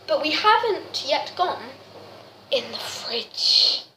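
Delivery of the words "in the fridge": slow, spooky whisper. Acted. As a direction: whisper it like a secret. Ominous build-up to fridge.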